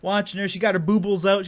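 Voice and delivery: ordinary talk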